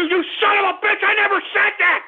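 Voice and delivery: high, angry outburst; furious denial. Acted. Radio host yelling at the callers, furious. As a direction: yell the denial at them. Ranting host denies clip.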